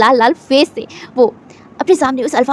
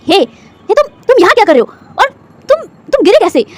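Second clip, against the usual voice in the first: shocked; 6 semitones higher than usual. A narrator's reading. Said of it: "वो shock होते हुए कहता है".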